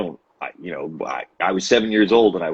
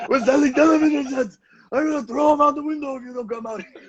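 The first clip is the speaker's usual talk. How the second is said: mock Crazy Gideon. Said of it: "He's just like".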